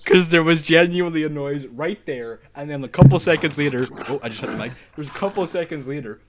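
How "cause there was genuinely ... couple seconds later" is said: jumpy. Real, not acted. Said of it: "I'm actually kinda jumpy right now".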